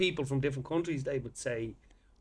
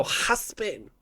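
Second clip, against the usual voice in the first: husband said as huspin with Spanish sounds; Spanish accent; 3 semitones higher, 4 dB quieter than usual. Retold or imitated. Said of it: "They go".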